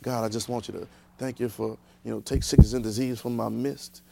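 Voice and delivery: low, soft, mumbled table prayer; mock long-winded prayer. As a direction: imitate a rambling blessing over the food. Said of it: "You know, we used to".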